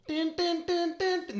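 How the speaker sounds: high, rhythmic "din" notes; imitated synth riff. Recalling a song for co-hosts, imitated riff. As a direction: mimic a dance-track riff with your voice.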